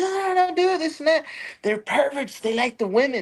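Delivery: high-pitched mock panic; mimicking the wife. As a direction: play the frantic wife warning him. Wife voiced in alarm.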